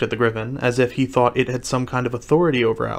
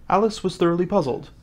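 plain reading voice

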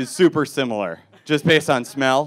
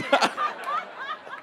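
high squeaky laugh; 6 dB quieter than usual. Real, not acted. Reacting to drunk woman.

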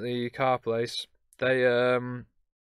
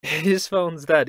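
laughing; 4 semitones higher, 8 dB louder, slower than usual. Real, not acted. Laughing retelling of mishap.

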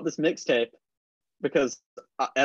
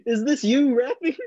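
higher, slow, laughing through the question; 10 semitones higher, 5 dB louder than usual. Retold or imitated. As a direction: ask while cracking up. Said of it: "This is what I said to him".

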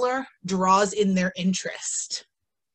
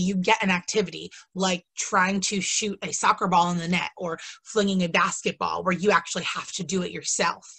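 ordinary talk

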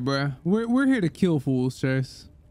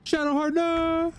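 shout; 12 semitones higher than usual. Real, not acted. Companion does something bad.

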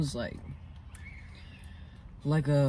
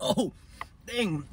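high sharp oh dang; surprised; 8 semitones higher than usual. Real, not acted. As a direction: caught off guard by heat. Burned by spicy snack.

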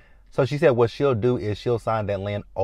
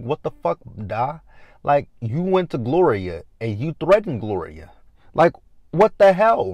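raised-pitch retold outrage; pissed off; 5 semitones higher, 4 dB louder than usual. Recapper voices Victor going off on Walter, pissed off. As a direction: go off on him in mock anger. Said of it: "Victor is pissed off at him".

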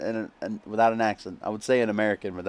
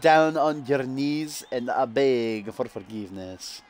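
slow mock Scottish accent sliding into Russian; Dracula-like Scottish accent, very slow; fake Scottish turning Russian; 2 semitones higher, slower than usual. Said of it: "turns to fucking Russian or something".

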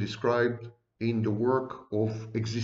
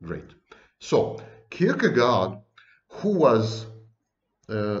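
ordinary delivery

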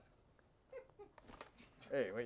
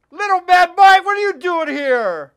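louder, higher, calling out the name; loud mock greeting; 10 semitones higher, 10 dB louder than usual. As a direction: hail him across the stage. Greeting the rival guest.

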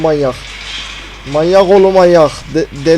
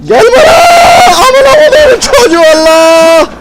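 high, loud panicked shriek; terrified scream; 9 dB louder than usual. Real, not acted. Gamer swearing at the monster in a jump scare, terrified scream. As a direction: scream out curses as it jumps at you.

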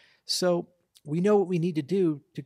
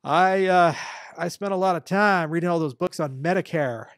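pompous know-it-all voter; 3 semitones higher, 8 dB louder than usual. Retold or imitated. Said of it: "somebody's like".

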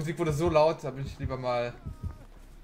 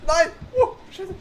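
high startled shriek with laugh; panicking gamer; 12 dB louder, slower than usual. Real, not acted. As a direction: shriek in fright then laugh. Nearly ran into killer. (erschrockener Aufschrei)

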